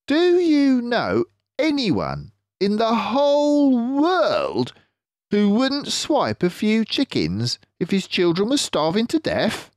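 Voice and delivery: clever fox leader, pleased with himself; Mister Fox voice. Acted.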